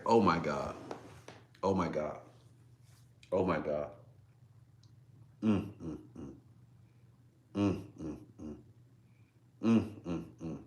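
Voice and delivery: low, soft murmurs to self; real emotion, sinking into quiet moans; overcome, near tears. Real, not acted. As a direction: repeat it low and softly, lost in feeling. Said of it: "brings tears to my eyes".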